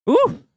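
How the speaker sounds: sudden high, loud "ooh"; real high-pitched whoop of delight; excited ooh. Real, not acted. Gamer reacts to a good one, excited high ooh.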